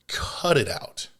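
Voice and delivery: a sharp whispered scold; a whispered telling-off to the viewer. Shown as a demonstration. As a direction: hiss it under your breath like a warning. Scolding bad sushi habits.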